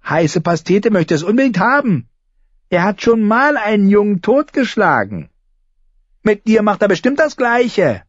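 put-on high, wheedling boy's voice; needling boy. Lommi urging Arya to hand over the sword, high and needling. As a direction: press her with a gloating threat.

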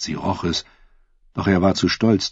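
ordinary delivery, nothing marked

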